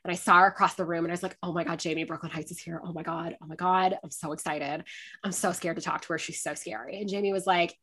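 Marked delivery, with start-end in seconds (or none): 1.4-7.0 quiet, low excited murmur; hushed star-struck panic. Retold or imitated. Fan spotting an idol across the room, hushed and excited. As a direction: keep the gushing under your breath.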